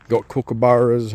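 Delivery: ordinary talk, nothing marked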